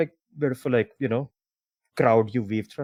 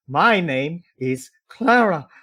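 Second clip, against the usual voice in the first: slow, higher-pitched affronted correction; indignant correction; 5 semitones higher, slower than usual. Acted. Tiefling woman slaps man over wrong name, indignant. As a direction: stretch each word in offended reproof.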